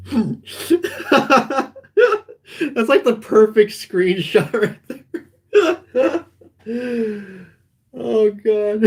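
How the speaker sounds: high-pitched talk through real laughter; losing it over the perfect screenshot; laughing. Real, not acted. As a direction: near tears from laughing.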